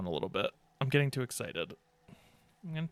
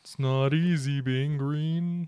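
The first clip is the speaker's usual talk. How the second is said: slow, put-on frog puppet voice; a badly faked Kermit singing slowly; bad Kermit impression; slower than usual. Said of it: "a really bad Kermit impression".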